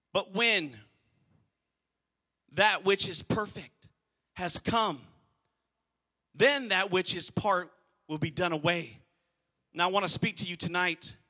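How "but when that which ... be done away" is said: slow scripture reading. Shown as a demonstration. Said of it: "Now listen to this".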